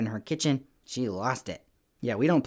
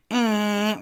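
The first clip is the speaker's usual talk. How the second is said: high, very loud horn blare; imitated air horn; 10 semitones higher, 7 dB louder than usual. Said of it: "really, really loud".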